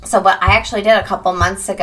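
ordinary talk

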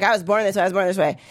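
lightly voiced mocking chant; mock repeated plea. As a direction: drone the slogan.

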